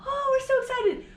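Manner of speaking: high, sing-song gushing; gushing parent. Retold or imitated. Mimicking delighted parents on the show, high gushing. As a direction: gush like a thrilled parent. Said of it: "some of the parents are like".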